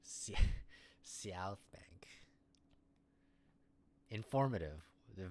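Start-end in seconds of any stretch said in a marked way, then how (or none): 0.0-2.1 very slow "South Bank" with a hissed S, breaking into a laugh; snake-like S. Shown as a demonstration. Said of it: "I have to enunciate the S like I'm a snake".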